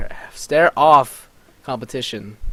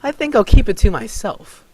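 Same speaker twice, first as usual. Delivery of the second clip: smug taunt; 10 semitones higher, 8 dB louder than usual. Acted. Varlin grinning, taunting Dunny.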